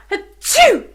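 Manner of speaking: high, loud fake sneeze. Fairy sneezing behind the chair. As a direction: burst out a big achoo.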